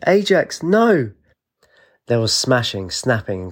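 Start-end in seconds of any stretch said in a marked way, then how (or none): 0.1-1.1 high, loud desperate shriek; piercing cry of despair. A narrator's reading. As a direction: scream his name as he's left behind. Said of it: "She shrieked".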